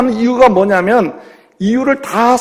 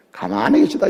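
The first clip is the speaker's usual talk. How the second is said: God's reply; 7 semitones lower, 7 dB quieter than usual. Acted. God replies in joke.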